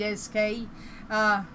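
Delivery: ordinary talk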